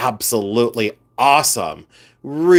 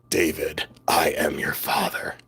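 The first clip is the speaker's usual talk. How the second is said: creepy, low dad voice; deep, soft and half-whispered from the chest; 10 semitones lower, 7 dB quieter than usual. Shown as a demonstration. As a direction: breathe it out very low, creepy father. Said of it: "I said it in my dad voice".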